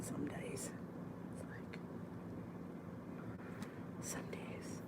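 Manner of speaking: hushed confiding whisper. Real, not acted. Wife confiding about husband's mess, whispered. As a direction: drop to a whisper for the aside.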